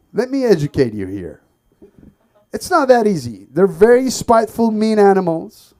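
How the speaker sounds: loud, high indignant rant; mock lecture rant. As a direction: lecture him, outraged and loud. Ranting back at instructor.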